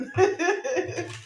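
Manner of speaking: high, quick giggle; real tickled laugh. Real, not acted. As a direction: giggle at your own remark. Amused at getting heated up.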